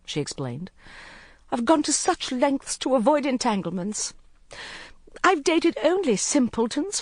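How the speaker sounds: agitated, self-pitying rant; Court, upset young woman. A narrator's reading. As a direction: pour out her grievances. Narrator voices Court.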